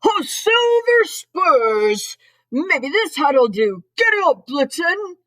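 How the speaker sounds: high, loud, very drawn-out whoop; cowboy Santa exclaiming. A narrator's reading. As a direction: exclaim it big like a storybook cowboy. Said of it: "he exclaimed".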